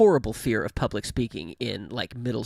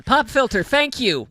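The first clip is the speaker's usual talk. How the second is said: high, loud burst of relief at remembering; relieved exclamation; 9 semitones higher, 6 dB louder than usual. Real, not acted. Streamer suddenly recalls the name of her pop filter, relieved exclamation. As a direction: blurt it out, finally finding the word.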